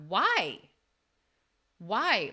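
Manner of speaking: a mild mock scream of why; retold angry cry. Coach retelling screaming at God, mild angry cry. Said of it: "Like, screaming to God".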